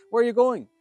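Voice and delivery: a king's sharp challenge; indignant shout. Acted. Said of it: "He said".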